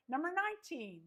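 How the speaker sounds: ordinary talk, nothing marked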